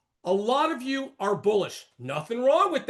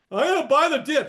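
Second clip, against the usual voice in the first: higher, louder mocking quote; mock eagerness; 6 semitones higher, 5 dB louder, faster than usual. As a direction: mimic an overeager buyer. Mocks eager dip-buying viewers.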